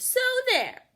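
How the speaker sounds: high, loud taunt; bratty so there. A narrator's reading. Camilla sassing the class as she leaves. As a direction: fling it back defiantly. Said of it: "And then she added".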